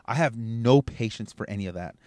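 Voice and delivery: normal talking voice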